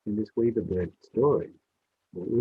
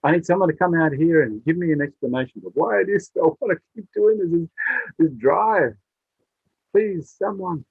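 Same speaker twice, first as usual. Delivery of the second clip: distressed weaver bird; 6 semitones higher, 5 dB louder than usual. Acted. Bird keeps pleading for help.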